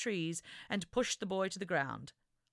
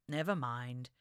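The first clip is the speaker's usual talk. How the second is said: sighed; 6 semitones lower than usual. Acted. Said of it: "he sighed".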